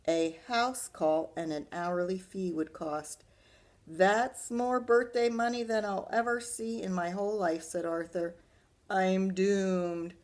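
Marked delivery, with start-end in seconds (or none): 3.9-10.1 higher, louder wail of dismay; dismayed Arthur. Acted. Arthur groaning to himself about the repair cost, higher and louder. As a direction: moan it like all is lost.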